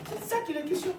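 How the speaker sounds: high-pitched, ringing statement to the congregation. Real, not acted. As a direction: lift the whole line up high. Rhetorical line, first raised. (ton haut et insistant)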